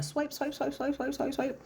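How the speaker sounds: high, soft run of repeats; high-pitched repetitive chant; rapid-fire swiping. Acted. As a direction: mimic endless card swiping in a high voice. Mimics endless card swiping.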